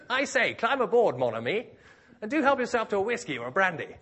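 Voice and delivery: high, loud hammed-up toff; hearty mock-gentleman invitation; jovial yachtsman. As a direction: hail a stranded neighbour from your yacht. Rich yachting neighbour voiced in joke.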